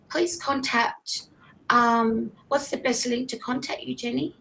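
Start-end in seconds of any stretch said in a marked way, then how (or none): none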